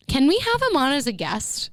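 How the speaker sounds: usual speaking voice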